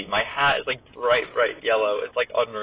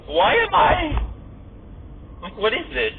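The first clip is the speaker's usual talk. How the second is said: surprised; 10 semitones higher, slower than usual. Real, not acted. Confused by sudden navigation route.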